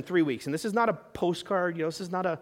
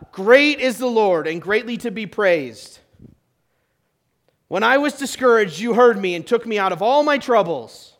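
loud, raised preacher's reading; scripture proclamation; 3 semitones higher, 7 dB louder than usual. Acted. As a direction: proclaim the verse with full voice. Reads scripture aloud.

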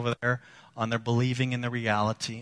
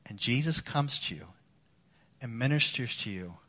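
much softer, lower, gentle sermon voice; tender consoling; 3 semitones lower, 6 dB quieter than usual. Acted. Pastor consoling broken listeners, soft and low. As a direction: comfort them almost at a hush.

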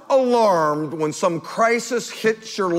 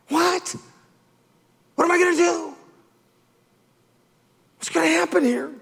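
panicked reaction; 9 semitones higher, faster than usual. Pretend. Acting out alarmed reaction.

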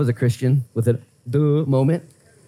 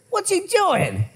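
much higher, gossipy angel voices egging each other on; mocking gossipy angels; 13 semitones higher, much slower than usual. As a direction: play a nosy angel calling the others over to watch. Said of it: "And the angels are like".

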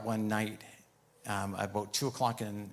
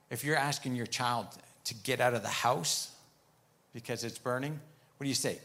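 ordinary talk

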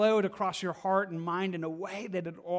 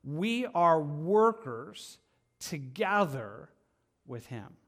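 slow it down, lean on each word; We are workers together, drawn out slowly; slow emphatic phrase; much slower than usual. Acted. Preacher to church, slow emphatic.

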